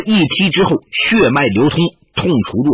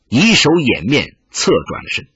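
plain reading voice

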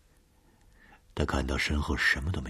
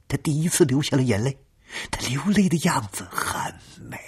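high, loud, unsettling; high eerie voice; 11 semitones higher, 5 dB louder than usual. Acted. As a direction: rise high and strange, like a ghost. Caller's ghostly climax.

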